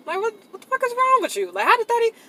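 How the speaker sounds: high, incredulous cry; outraged disbelief. Real, not acted. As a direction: shout your disbelief at the absurdity. Outraged at his response.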